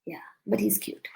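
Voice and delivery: soft unvoiced whisper; whispered aside. Real, not acted. Teasing aside about son.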